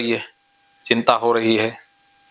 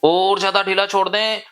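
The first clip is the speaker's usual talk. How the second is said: higher-pitched, sustained commands with stretched words; insistent hypnotic induction; 7 semitones higher than usual. Real, not acted. Hypnotist urging a client to let the legs go, insistent chant. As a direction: press the suggestion home on a raised, steady note.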